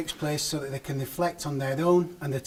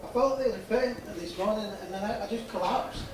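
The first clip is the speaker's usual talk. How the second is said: mannequin voice; 6 semitones higher than usual. Acted. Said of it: "I'll be actually the mannequin's voice today".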